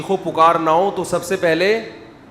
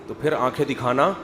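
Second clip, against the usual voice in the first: ordinary talk, nothing marked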